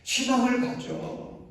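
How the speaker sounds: high, slow urging; self-encouragement. Shown as a demonstration. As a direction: lift your own soul slowly. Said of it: "좀 얘기해 주세요". (높고 느리게 자신을 북돋우며)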